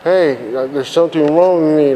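slurring after a stroke; words slurred and smeared together; slurred speech. Retold or imitated. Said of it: "slurring like this. / like that".

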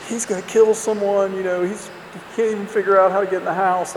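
the mom pleading with dad; worried mom. Retold or imitated. Mom warns dad about son.

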